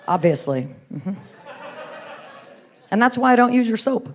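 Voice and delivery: normal talking voice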